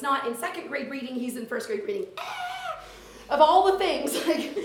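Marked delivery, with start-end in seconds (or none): 2.2-2.8 high-pitched mock scream with a laugh; exasperated squeal. Teacher finding her schedule is wrong, exasperated squeal. 3.3-4.6 loud, higher start breaking into laughter; laughing exasperation. Real, not acted. Teacher lamenting a schedule mix-up, laughing exasperation.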